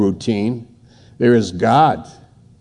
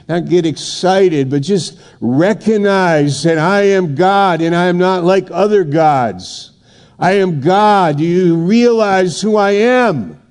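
emphatic, higher-pitched voice of God; imitating God in a preacher's raised pitch; God declaring himself; 6 semitones higher than usual. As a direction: declare it with insistent authority, pitched up. Acts out God addressing the speaker.

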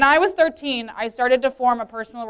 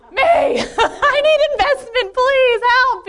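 much higher, laughing, slow comic cry for help; laughing mock plea; 11 semitones higher, 4 dB louder, slower than usual. Jokingly volunteering herself to leaders, laughing high plea. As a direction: laugh while begging to be noticed.

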